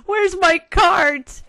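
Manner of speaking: slow, high, stretched-out wail; drawn-out wail. Real, not acted. Gamer calling out for missing cart, slow high wail. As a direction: drag the question out in despair.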